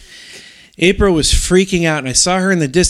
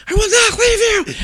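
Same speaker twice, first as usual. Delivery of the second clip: retold yelling. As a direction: yell reassurance to the kids in the storm. Yelling to kids in blizzard.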